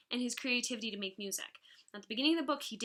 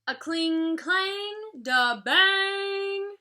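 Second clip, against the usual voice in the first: loud, high clanging rhythm; loud rhythmic clang; train wheels; 9 semitones higher, 8 dB louder, much slower than usual. A narrator's reading. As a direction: pound it out like thundering train wheels.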